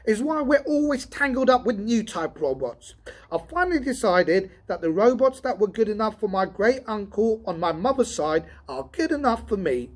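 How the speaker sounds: furious grumbling about new robots; savage rant. Acted. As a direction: rant it out in full anger. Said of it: "said Donovan in sudden savagery".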